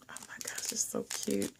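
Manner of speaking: breathless whisper of delight; awed whisper. Real, not acted. Vlogger cooing over a cute enamel pan, awed whisper. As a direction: whisper it like a precious secret.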